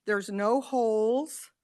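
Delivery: ordinary talk, nothing marked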